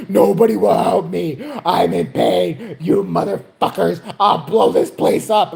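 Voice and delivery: loud, slow hammed-up wailing rage; high-gear outburst. Old man wants the back room, loud furious tantrum. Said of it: "then he kicked it in high gear".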